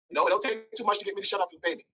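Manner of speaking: normal talking voice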